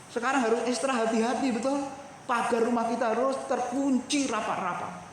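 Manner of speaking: ordinary talk, nothing marked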